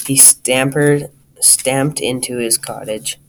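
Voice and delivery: ordinary delivery, nothing marked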